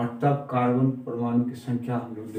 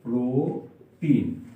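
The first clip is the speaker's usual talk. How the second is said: Propene drawn out slowly, syllable by syllable; slow clear naming; much slower than usual. Shown as a demonstration. Teacher to class, drawing out propene slowly. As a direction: stretch out propene so students catch it. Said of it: "इसका नाम हो गया".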